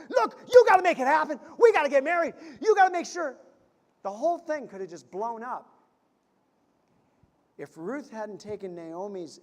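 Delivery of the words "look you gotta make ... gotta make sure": much higher and louder, fast pleading; worked up, pleading. Retold or imitated. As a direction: urge him frantically to make it happen. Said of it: "Ruth could have got herself all worked up".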